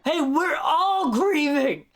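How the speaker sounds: high, loud, very slow imitation of Deadpool's line; imitating Deadpool. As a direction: mimic the clip, stretching every word. Repeats Deadpool's line from clip.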